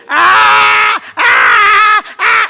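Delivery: loud, high newborn wail; full-volume imitation of a baby screaming; screaming baby cry. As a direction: bawl in repeated bursts like a hungry baby. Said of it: "Empieza a gritar, empieza a llorar".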